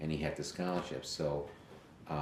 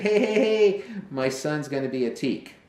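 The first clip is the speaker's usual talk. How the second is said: loud, much higher hey hey hey; excited bragging; 11 semitones higher, 10 dB louder than usual. Retold or imitated. Proud dad tells his fraternity brothers the news, loud and thrilled. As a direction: shout the news to old friends.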